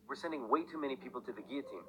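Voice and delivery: ordinary talk, nothing marked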